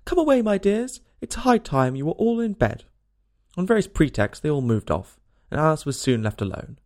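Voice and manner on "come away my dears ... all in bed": high, anxious calling; trembling voice. A narrator's reading. Canary calls its children away, in a trembling voice. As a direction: call out high and nervously, trembling. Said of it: "called out in a trembling voice to its children".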